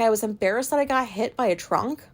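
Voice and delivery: normal talking voice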